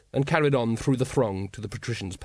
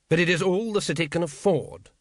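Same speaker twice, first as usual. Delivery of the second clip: firm; 6 semitones higher than usual. A narrator's reading. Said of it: "said the patrician firmly".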